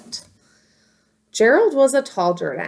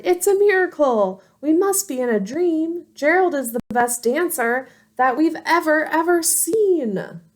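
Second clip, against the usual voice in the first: amazed shout; 8 semitones higher than usual. Acted. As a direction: gasp it out like a crowd in awe. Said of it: "They shouted".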